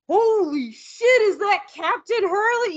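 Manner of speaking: high, slow, delighted call from afar; excited call across a room. Retold or imitated. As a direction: call out in happy disbelief. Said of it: "I heard from across the room".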